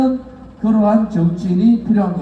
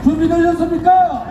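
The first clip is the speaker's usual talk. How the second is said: higher, stronger shouted repeat; roaring crowd call; 5 semitones higher than usual. Real, not acted. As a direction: push the call harder, higher. Rally leader repeats the call louder.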